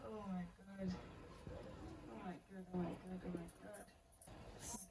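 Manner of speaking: awed hush. Real, not acted. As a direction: murmur in awe at the back of the dress. Awed whisper at the dress.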